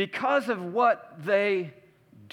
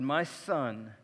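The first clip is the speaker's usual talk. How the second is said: tender father; 6 semitones lower, 6 dB quieter, slower than usual. Retold or imitated. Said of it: "And the father said".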